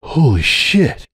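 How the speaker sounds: slow, breathless oath; the air's half knocked out of him; short of breath. Acted. Hoops, stunned at meeting his son, says it breathlessly. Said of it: "still short of breath".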